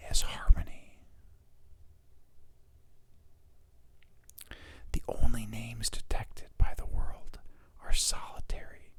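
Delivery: intimate whispered reading; ASMR whisper. Shown as a demonstration. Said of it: "doing the A S M R thing".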